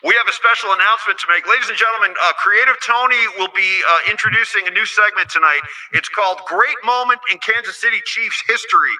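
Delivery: loud booming stadium announcement; announcer voice. Acted. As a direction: boom it out like an arena announcer. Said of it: "let me get my announcer voice here".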